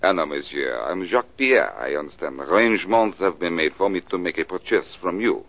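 hammed-up French accent, posing as a Frenchman. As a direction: play a smooth Frenchman with a stage French accent. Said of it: "Gonna try out your French accent?".

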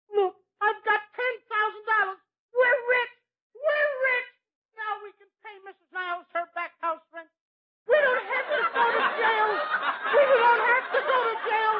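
high, laughing, giddy with joy; overjoyed. Acted. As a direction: squeal with happiness over the money. [name] overjoyed about the money.

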